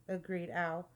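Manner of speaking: ordinary delivery, nothing marked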